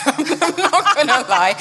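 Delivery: laughing. Real, not acted. Laughing at her own selfish question.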